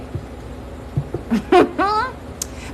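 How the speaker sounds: long, very high breathy laugh; amused laugh. Real, not acted. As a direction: let a high laugh spill out slowly. Amused by her bye-bye line.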